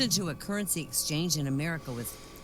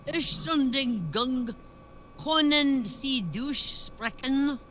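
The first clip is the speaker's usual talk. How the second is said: slow, higher, louder German; German phrase; 9 semitones higher, 5 dB louder, much slower than usual. Retold or imitated. Swiss man asking Englishmen directions in German. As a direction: ask slowly in German with a higher voice. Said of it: "he says".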